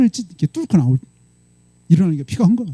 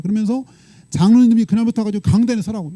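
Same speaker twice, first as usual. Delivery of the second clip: ordinary talk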